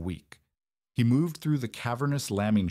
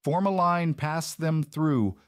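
high, raised voice giving orders; authoritative command; 8 semitones higher, 3 dB louder, slower than usual. A narrator's reading. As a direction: call it out like one born to command. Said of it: "authoritative cadence of a man born to command".

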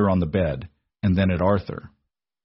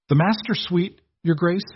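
higher, startled stammer of a servant; a flustered maid caught off guard; 10 semitones higher than usual. A narrator's reading. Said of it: "Missus Gable stammered".

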